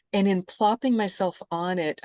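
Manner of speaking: ordinary talk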